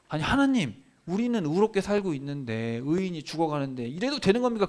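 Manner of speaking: high-pitched aggrieved plea; acted incredulous complaint; protesting to God. Acting Habakkuk's protest to God.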